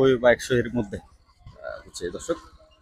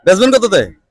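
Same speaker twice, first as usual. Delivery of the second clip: raised, loud call across the market; 7 semitones higher, 10 dB louder, slower than usual. Real, not acted. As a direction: call out to the seller over the noise. Calling out to a seller.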